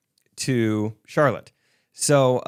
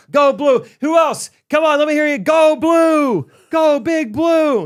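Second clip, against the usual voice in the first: high, loud rally cry; cheering shout; 8 dB louder, slower than usual. Retold or imitated. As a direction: rouse a crowd at full voice. Retold cheering on speaker.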